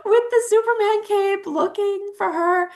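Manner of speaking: can't hold back a laugh at the image. Real, not acted. Mom laughing as she pictures her husband in a Superman cape.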